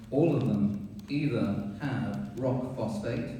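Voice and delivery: ordinary talk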